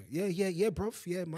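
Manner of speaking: mock Nigerian London slang; a light Nigerian accent on yeah bruv. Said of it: "I came back with a hefty accent".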